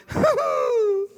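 high, breathless squeal of a laugh. Shown as a demonstration. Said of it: "do that kind of squeal thing".

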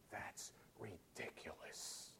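slow, drawn-out whisper of outrage; whispered mock shout. Preacher showing his urge to shout at the TV, hushed whisper. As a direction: shout at the TV without any voice. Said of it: "I wanted to shout to the T V".

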